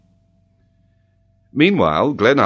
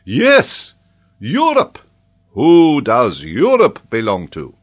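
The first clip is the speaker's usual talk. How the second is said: loud, high, insistent questioning; worked-up scholar pressing his pupil; excited professor; 6 semitones higher, 9 dB louder, slower than usual. Acted. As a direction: demand it with slow, heavy emphasis. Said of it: "Paganel's excitement".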